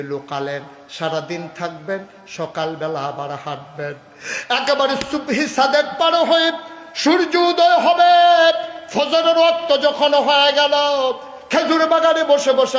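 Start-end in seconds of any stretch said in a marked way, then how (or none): 4.5-12.8 high, loud sermon storytelling; full-force preaching. Real, not acted. Preacher telling of dawn at the date garden, full-force chant. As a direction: belt out the tale to the crowd.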